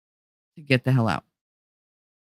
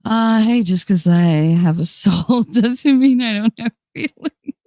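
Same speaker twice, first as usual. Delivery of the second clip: higher, louder, slow whiny plea; whiny mock protest; 7 semitones higher, 5 dB louder than usual. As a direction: plead in a put-on hurt voice.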